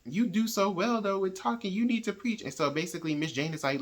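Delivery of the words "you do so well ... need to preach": higher, coaxing church voices; lightly voiced pleading crowd; urging churchfolk. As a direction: mimic the eager congregation. Congregation urging Jimmy.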